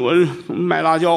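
ordinary talk, nothing marked

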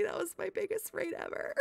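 laugh-cry of real gratitude; overwhelmed, half laughing, half crying; laughing through tears. Real, not acted. Overwhelmed by biggest raid ever.

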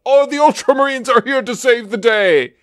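high, triumphant heroic announcement; mock heroic cheer. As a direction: cheer it like a cheesy rescue. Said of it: "it always ends up".

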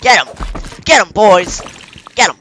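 loud rallying shout. Real, not acted. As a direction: fire up the troops. Cheering on his troops.

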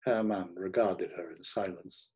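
ordinary delivery, nothing marked

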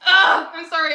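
loud, high gushing ugh; overwhelmed squeal. Real, not acted. Gushing over the cute gameplay, a loud high squeal. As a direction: burst out, overcome with cuteness.

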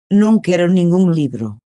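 usual speaking voice

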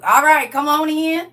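high, drawn-out country Southern call. Pretend. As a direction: beckon folks in with a put-on country twang.

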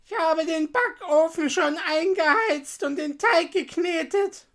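high, loud storybook witch; old witch. A narrator's reading. Witch luring Gretel to the oven, loud witch voice. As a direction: speak up in a high, scheming witch voice. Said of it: "sagte die Alte".

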